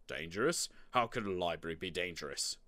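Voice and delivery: low, incredulous dad question; dad's voice. A narrator's reading. Dad doubting his son's fear, low incredulous question. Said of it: "Dad asked".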